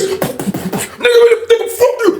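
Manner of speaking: very high, broken mimicked scuffle shouts; drunken brawlers. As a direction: imitate a drunk scuffle in high, scattered bursts. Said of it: "sounded like a bunch of drunk".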